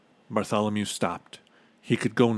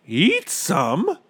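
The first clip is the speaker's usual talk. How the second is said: high, aghast question; high voice rising at the end; gasping disbelief; 9 semitones higher, 5 dB louder than usual. A narrator's reading. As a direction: echo it in shock. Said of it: "gasped Bartholomew".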